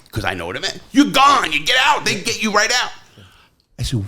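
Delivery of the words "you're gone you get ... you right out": high, loud excited yelling; thrilled cellmate shouting. Retold or imitated. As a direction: burst out with the good news. Excited news of release.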